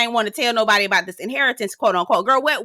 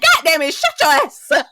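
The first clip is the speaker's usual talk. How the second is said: loud, higher mock outburst; retold exasperated outburst; 5 semitones higher, 4 dB louder than usual. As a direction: imitate a fed-up table yelling. Whole table snapping at Mia.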